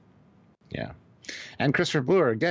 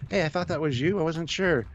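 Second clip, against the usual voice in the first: imitated fan; 7 semitones higher than usual. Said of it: "they were just like".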